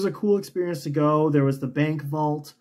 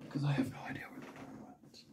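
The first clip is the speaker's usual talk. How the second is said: whisper; 15 dB quieter, faster than usual. Real, not acted. Muttering while searching for yarn.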